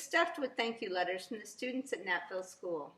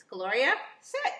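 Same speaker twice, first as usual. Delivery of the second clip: loud, high sharp command to a dog; barked dog command; firm command; 4 semitones higher, 7 dB louder than usual. Acted. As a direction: order the dog to sit, loud.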